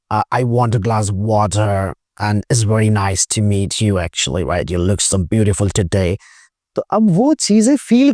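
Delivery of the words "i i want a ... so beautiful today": slow, low Russian accent; lower, heavy Russian accent, dragging each word. Shown as a demonstration. Teacher showing how a Russian speaks English, low and slow. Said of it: "अब वो Russian accent है".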